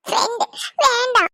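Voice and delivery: put-on a small boy pleading not to eat, half sobbing; whining child. Child voice continues. (சாப்பிட மாட்டேன் என்று அழும் சிறுவன் குரல்)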